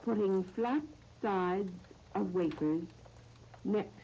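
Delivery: slow, measured phrases with long pauses; recipe dictation. Real, not acted. Radio host reading out a recipe to listeners, slow dictation. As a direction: dictate it piece by piece, leaving time to write.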